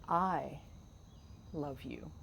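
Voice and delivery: "I" held apart, pause, then a soft falling "love you"; stress on I. Shown as a demonstration. Host showing where the emphasis goes, stress on "I". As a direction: isolate "I", then let "love you" fall away softly. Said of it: "where you put the emphasis".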